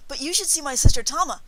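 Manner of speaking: put-on high, loud, excited boy's voice; bursting with boyish pride; brightened, proud boy. A narrator's reading. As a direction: brag eagerly about a big sister. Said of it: "Then he brightened".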